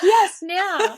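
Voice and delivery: screaming insistence. Retold or imitated. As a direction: shriek it back at him, insistent.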